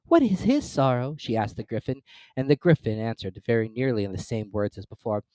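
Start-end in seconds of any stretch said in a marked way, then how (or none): 0.1-1.1 put-on higher, louder girl asking; concerned girl. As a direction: ask with gentle concern. Alice voiced by narrator.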